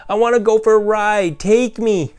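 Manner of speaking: put-on high, loud child's voice pleading; eager little girl. A narrator's reading. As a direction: beg for the ride like an excited young girl. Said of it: "Mabel yelled".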